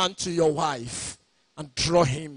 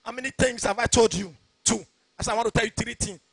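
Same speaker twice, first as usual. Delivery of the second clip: ordinary talk, nothing marked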